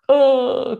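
a high, loud wailing oh; crying oh. Retold or imitated. Kids hearing their dad will die, wailing. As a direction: cry out like upset kids.

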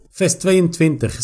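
ordinary talk, nothing marked

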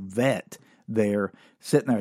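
ordinary talk

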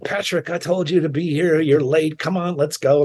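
lightly voiced impatient man in a suit chiding the latecomer; impatient hotel staffer. Suited hotel staff hurrying the band.

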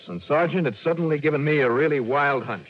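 rising urgency as the idea lands; sudden excitement. Acted. Detective to sergeant, suddenly excited and urgent.

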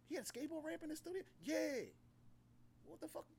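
high, fast disbelief; incredulous. Retold or imitated. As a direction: ask it in disbelief. His quoted disbelief.

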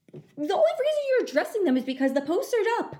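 real indignation, high and loud; outraged retort. Real, not acted. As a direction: fire back at the absurdity, pitch way up. Outraged at the judge's words.

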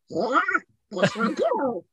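a touch of buccal duck voice; Donald Duck voice. Retold or imitated. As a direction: squawk it lightly like a cartoon duck. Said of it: "you do the Donald Duck voice".

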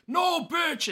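mocking friend. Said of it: "this fool was always like".

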